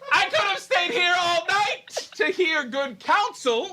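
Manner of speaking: high, loud laughing gush; delighted shout. Acted. Nurse gushing loudly at Romeo's counsel. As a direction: gush it out like a thrilled old nurse.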